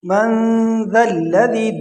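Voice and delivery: both ذ in ذا الذی as soft th-in-this; soft dhal. Shown as a demonstration. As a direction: recite من ذا الذی, nasal hold then soft ذ. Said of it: "دونوں کو آپ نے نرم پڑھنے ہیں". (دونوں ذال نرم)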